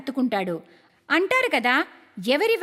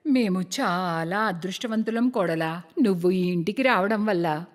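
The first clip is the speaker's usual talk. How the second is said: put-on older in-law praising; 9 semitones lower than usual. Elder praises daughter-in-law.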